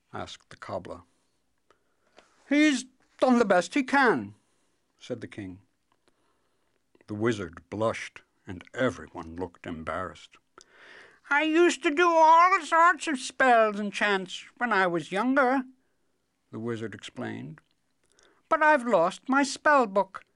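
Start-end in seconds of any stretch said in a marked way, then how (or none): 2.5-4.3 high, loud royal voice; protesting king. Acted. King excuses the wizard before everyone, protesting. 11.3-15.6 very high, loud character voice; doddering wizard. Acted. Wizard explains to everyone about his lost powers, doddering.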